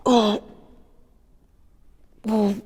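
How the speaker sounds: loud strained shout mid-rep; straining shout. Real, not acted. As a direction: force it out through the strain. Straining through the last reps.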